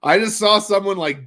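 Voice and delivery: usual speaking voice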